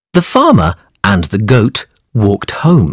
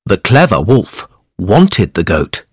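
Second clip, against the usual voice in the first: ordinary delivery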